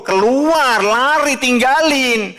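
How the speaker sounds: high, loud preacher's call to run; preaching shout. Real, not acted. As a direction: cry it out like a fiery preacher. Preacher urging them to flee. (teriakan khotbah yang keras)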